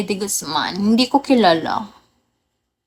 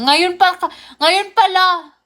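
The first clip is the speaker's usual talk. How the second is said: higher, louder tongue-tied stumble; nabubulol; 6 semitones higher, 3 dB louder than usual. Real, not acted. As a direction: trip over the words and restart. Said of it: "nabubulol ako". (nabubulol, paulit ang simula)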